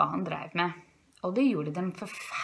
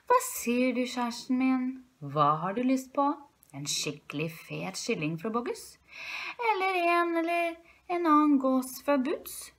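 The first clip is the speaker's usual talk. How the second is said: Herr Rev, ivrig ektemann; 5 semitones higher than usual. Acted. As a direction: play a doting fox offering dinner choices. (høy, innsmigrende revestemme)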